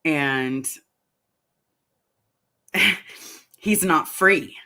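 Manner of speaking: ordinary talk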